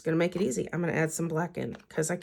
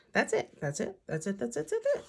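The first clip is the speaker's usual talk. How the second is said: singsong repeats; 8 semitones higher than usual. Pretend. Playful singsong repetition.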